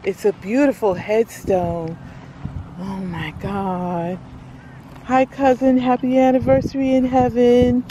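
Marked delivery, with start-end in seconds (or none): 2.8-4.2 whispered and slowed; awed whisper. Real, not acted. Seeing her cousin's headstone, awed whisper. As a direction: breathe it out in awe.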